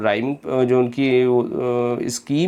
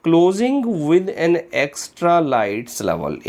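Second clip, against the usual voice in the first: deliberate dictation pace; slow option reading; 5 semitones higher, much slower than usual. Shown as a demonstration. Teacher to exam students reading an option, slow and deliberate. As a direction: read the answer choice slowly, each word set apart.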